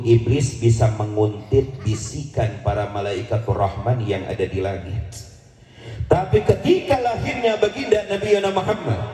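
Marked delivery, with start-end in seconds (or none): 6.1-9.0 high, fervent sermon voice; full-force preacher declaiming; fiery preaching. Acted. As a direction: preach it out with rising fervor.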